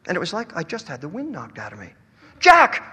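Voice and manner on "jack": high loud forlorn cry; boy calling out to his hero. A narrator's reading. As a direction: call after him as he leaves. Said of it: "I cried out suddenly".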